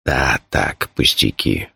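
low-voiced brush-off; deep, offhand, understated reply; dismissive. A narrator's reading. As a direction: wave it away as a trifle. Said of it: "отмахнулся он".

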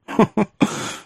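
brief high chuckle; acted royal chuckle; king's scoffing chuckle. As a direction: let out a short incredulous laugh. King laughs in the story.

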